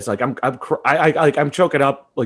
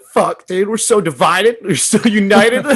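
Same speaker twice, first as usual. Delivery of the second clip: emotional outburst; 7 semitones higher, 5 dB louder, slower than usual. Retold or imitated. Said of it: "And it was like".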